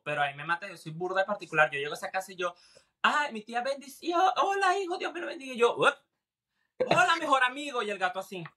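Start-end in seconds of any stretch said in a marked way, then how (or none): 3.0-5.5 put-on much higher, sing-song old aunt greeting; doting aunt. Aunt welcoming her nephew, high sing-song doting voice. 5.8-7.8 higher cooing voice for a pet; cooing at cat. Retold or imitated. Man greeting his cousin's cat, higher cooing voice.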